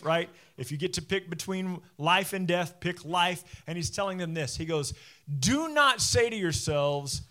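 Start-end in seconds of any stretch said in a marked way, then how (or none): 5.4-7.2 slow, high, loud quoted command; emphatic command. Retold or imitated. Preacher quoting Moses warning the people, emphatic command. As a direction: lay down the order firmly, stretching the words.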